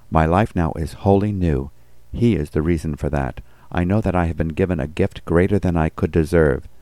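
ordinary talk, nothing marked

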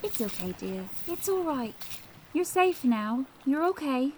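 put-on high, soft comforting old woman; soothing old lady. Elderly neighbour comforting Amanda after the attack, tender. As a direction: hold her and soothe.

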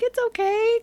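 very high, small reassuring voice; Pluto. Retold or imitated. Fan acts out Pluto reassuring Noah, high little voice. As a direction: soothe in a squeaky high register. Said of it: "And Pluto's like".

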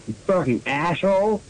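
ordinary delivery

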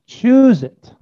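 high, loud insistence; emphatic command. Acted. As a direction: push it out high and forceful. God's command voiced, repeated forcefully.

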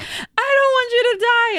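put-on high, childlike tearful plea; a little kid begging not to lose you; child's plea. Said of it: "be like".

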